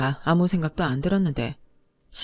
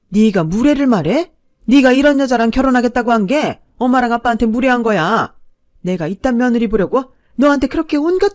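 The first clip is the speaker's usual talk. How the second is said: loud, raised-pitch maternal tirade; outraged mother; 6 semitones higher, 9 dB louder than usual. A narrator's reading. Mother throwing her sacrifices in her son's face, outraged. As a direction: berate the son at full indignation.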